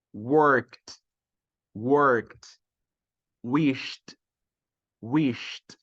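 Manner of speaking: each word alone, final "ed" said as a crisp t. Shown as a demonstration. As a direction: model "Washed" as washt, slowly and separately. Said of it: "Vai ter som de T".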